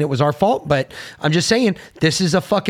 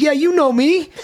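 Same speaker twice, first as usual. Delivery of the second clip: high, cocky, laughing through it; cheeky laughing comeback; laughing retort; 13 semitones higher than usual. Retold or imitated. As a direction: toss it off with a laugh.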